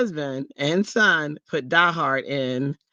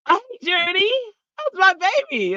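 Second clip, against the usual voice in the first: excited hi. Real, not acted. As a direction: gush over the little one. Greeting niece in chat.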